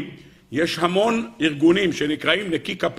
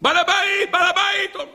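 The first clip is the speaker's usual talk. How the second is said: servant's scream; 13 semitones higher than usual. A narrator's reading. Servant outside calling the householder, screaming. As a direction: cry out in high-pitched alarm. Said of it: "המשרת צורח".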